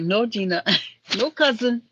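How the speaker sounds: playful, badly faked Hawaiian accent; imitated Hawaiian accent. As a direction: mimic a cousin's Hawaiian accent, laughing. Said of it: "I ain't doing the accent right".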